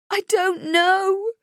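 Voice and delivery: loud, high, drawn-out wail. Acted. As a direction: stretch the words into a despairing wail. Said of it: "she wails".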